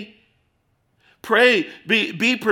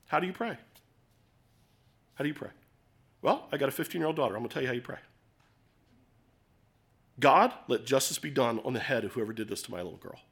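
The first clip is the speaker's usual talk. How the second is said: much softer and lower, a grieving father praying; hushed prayer; 4 semitones lower, 6 dB quieter, faster than usual. Acted. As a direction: let it drop to a near hush. Quiet father's prayer for justice.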